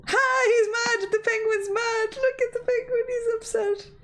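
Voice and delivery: high, delighted taunting of the bot; gleeful teasing. Real, not acted. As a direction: crow over the upset penguin. Gleefully mocking the upset penguin bot.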